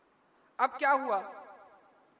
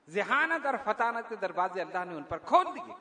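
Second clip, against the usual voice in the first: ordinary delivery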